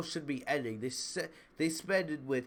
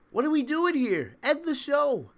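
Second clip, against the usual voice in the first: higher, louder "End the show" in real exasperation; exasperated outburst; 12 semitones higher, 8 dB louder than usual. Real, not acted. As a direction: throw up your hands and yell "End the show". Exasperated at the long ending.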